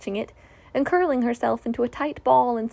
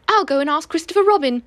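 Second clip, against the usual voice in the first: flustered rabbit; 6 semitones higher, 5 dB louder than usual. A narrator's reading.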